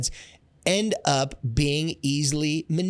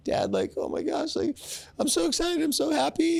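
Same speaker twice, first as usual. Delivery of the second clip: put-on squealing teenage girl voice, much higher; gushing teen daughter. A teenage daughter gushing to her dad, in a squealing high voice. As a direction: gush like a giddy fourteen-year-old. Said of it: "she's like".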